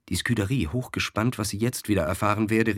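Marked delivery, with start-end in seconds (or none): none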